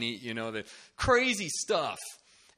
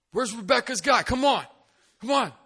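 very high, loud, impatient summons; calling out; 12 semitones higher, 10 dB louder than usual. Retold or imitated. Banquet crowd summoning Rebecca's partner, calling out. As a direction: holler across the banquet hall.

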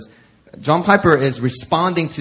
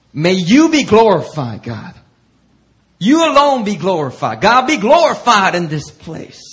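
louder, higher prayerful call; worship prayer voice; 6 semitones higher, 7 dB louder than usual. Retold or imitated. Preacher imitating a worship song prayer, louder. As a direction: lift it up like a worship leader.

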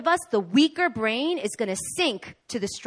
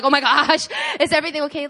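panicked; 5 semitones higher, 5 dB louder, faster than usual. Retold or imitated. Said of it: "I'm like".

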